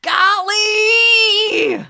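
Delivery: very high, drawn-out yell; screamed substitute curse. Retold or imitated. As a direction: scream it out long, re-enacting your fury. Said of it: "I wanted to scream".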